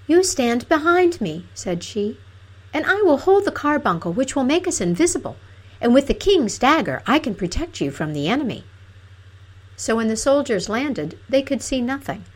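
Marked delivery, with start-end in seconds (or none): 0.1-8.6 high, bold princess voice; brave princess. Acted. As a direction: protective princess taking charge. Princess protects her lover.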